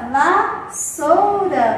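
VASSOURA stretched very slowly, va-ssou-ra; loud, slow teacher's VASSOURA, syllables held long. Shown as a demonstration. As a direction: draw out each syllable of VASSOURA for young spellers. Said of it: "Vamos escrever a palavra vassoura?".

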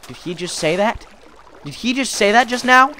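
acted appalled disbelief; appalled audience. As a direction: voice a scandalized crowd, climbing in pitch. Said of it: "then everybody was appalled".

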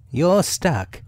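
lifted, matter-of-fact storybook animal; Rabbit. A narrator's reading.

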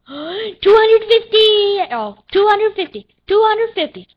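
high, loud gleeful shout; thrilled exclamation. Real, not acted. Gamer seeing two hundred fifty coins, thrilled shout. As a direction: crow over the windfall.

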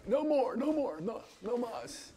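high, thin mock begging for mercy; overwhelmed audience. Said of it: "Everyone's just like".

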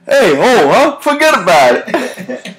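high, hammed-up New Jersey Italian accent; a wiseguy shrug in a New Jersey Italian accent; mock Italian-American. Host reads the reply comment as a wiseguy.

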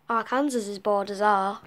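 ordinary talk, nothing marked

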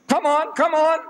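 high, pressing retold taunt; impatient goading. Reverend pressing the cornered Maulana for an answer, impatient goading.

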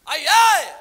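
loud call to the congregation; booming rally call. Real, not acted. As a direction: rouse the room with a shouted question. Preacher calls out to congregation.

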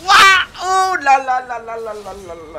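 long, high, loud amazed cry; excited. Real, not acted. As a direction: let it pour out in awe. Reacting to a huge play.

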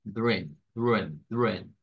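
"dren" with a reduced schwa vowel, pitched low; schwa syllable. Shown as a demonstration. Teacher drills the weak syllable of children, low schwa. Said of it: "Es the schwa".

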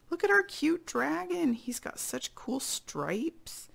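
very high cutesy voice; squeaky-high adoring aside; cooing. Real, not acted. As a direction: coo over it, stretching the last words. Aside cooing over picture.

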